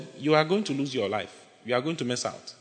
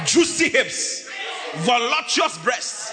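high, slow, drawn-out mock praise; hammed-up lust; 6 semitones higher, much slower than usual. Preacher mocking young men's lust, high slow hammed-up praise. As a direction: savour each word like a leering admirer.

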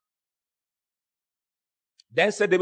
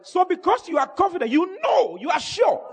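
high, ringing sermon delivery; full-force preaching; 9 semitones higher than usual. Real, not acted. Preacher telling church they are sure of glory, full-force preaching.

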